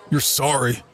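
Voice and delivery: mocking bully. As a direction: taunt him like a grinning bar bully. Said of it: "gave me a yellow-toothed smile and repeated".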